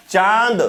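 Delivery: चांद as chãad, long vowel through nose and mouth; चांद, nasal vowel running straight into d; nasalized vowel. Shown as a demonstration. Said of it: "नाक से भी ध्वनि आएगी और मुख से भी".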